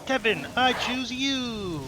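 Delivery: loud, high, drawn-out summoning call; mock battle cry. Player summons Kevin in a game, mock battle cry. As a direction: call out your champion, long and proud.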